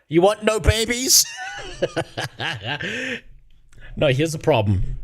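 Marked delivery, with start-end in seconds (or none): 0.1-1.3 high-pitched playful question; mock sales pitch. Streamer jokingly offering himself as contraception, mock high pitch. 1.3-3.2 high, soft laugh; trailing high laugh at own joke. Real, not acted. Streamer laughing at his own contraception joke, high laugh.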